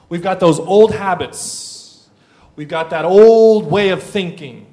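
loud, stretched key words; emphatic preaching. Real, not acted. Preacher sets sin against God for congregation, emphatic preaching. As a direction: preach it with swelling force. Preacher drives home contrast.

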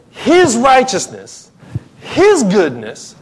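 high, loud, ringing preacher's climax; full-force preaching. Real, not acted. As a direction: proclaim it, hammering each repeated phrase.

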